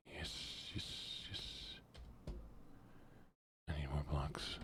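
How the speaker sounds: soft whisper under the breath. Real, not acted. Whispering to chat about needing blocks. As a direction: murmur it as a secret.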